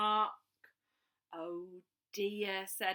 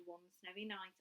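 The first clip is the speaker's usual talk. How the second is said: ordinary delivery, nothing marked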